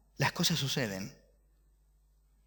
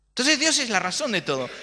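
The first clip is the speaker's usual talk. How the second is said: higher, louder proclamation; proclaiming; 8 semitones higher, 3 dB louder than usual. Shown as a demonstration. Preacher to congregation, booming loud repeat. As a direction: ring it out from the pulpit.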